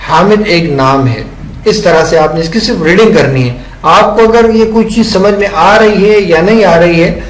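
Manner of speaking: ordinary talk, nothing marked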